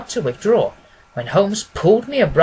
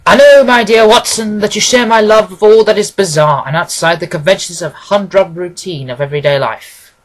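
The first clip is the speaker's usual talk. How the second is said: cordial Holmes; 9 dB louder than usual. A narrator's reading. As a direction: welcome your old friend in with open arms. Holmes lecturing Watson.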